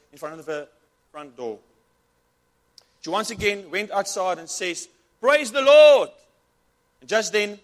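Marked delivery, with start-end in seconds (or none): 5.2-6.1 very loud, high cry of praise; shouted praise. Acted. Old lady finding groceries at her door, loud happy shout.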